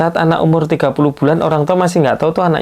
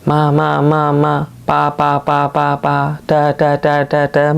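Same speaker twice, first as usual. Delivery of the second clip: put-on baby babbling demo; 2 semitones higher, slower than usual. Shown as a demonstration. Doctor shows parents how a baby babbles, lightly voiced.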